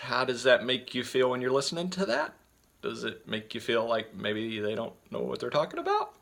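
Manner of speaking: statements and questions lifted high at the end; questioning uptalk. Shown as a demonstration. Coach showing listeners how unsure speakers sound, rising uptalk. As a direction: demonstrate an unsure upward lilt on each ending. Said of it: "their voice kinda goes up".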